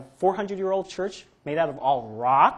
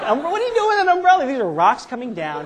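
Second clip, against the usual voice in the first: much higher, louder, fast, laughing disbelief; incredulous mockery; 9 semitones higher, 9 dB louder than usual. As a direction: laugh at how silly the umbrellas are. Mocking people opening umbrellas under rocks.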